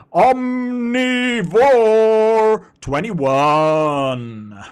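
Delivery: loud, high, drawn-out hype announcer. Pretend. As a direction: boom the names out like a ring announcer. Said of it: "I do an announcer voice".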